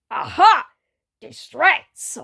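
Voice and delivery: loud gleeful shout; triumphant yell. Acted. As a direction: pounce on it triumphantly. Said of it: "Mau Mau yelled triumphantly".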